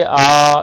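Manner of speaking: आ held long and loud, unchanged to the end; sustained vowel. Shown as a demonstration. Teacher to class, demonstrating a long steady आ. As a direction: draw out आ evenly to show it never changes. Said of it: "वैसे ही आ का उच्चारण करिए".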